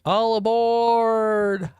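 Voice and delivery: high, long-held rock-intro call. Retold or imitated. Host imitating the Crazy Train opening, high drawn-out call. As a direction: stretch it out like a singer opening a train song. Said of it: "[name]'s Crazy Train song".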